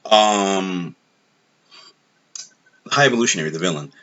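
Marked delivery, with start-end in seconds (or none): none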